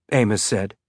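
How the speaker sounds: ordinary delivery, nothing marked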